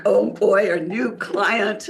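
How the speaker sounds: loud, eager burst of joy; excited. Retold or imitated. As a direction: gush like you just landed a client. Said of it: "I was so excited".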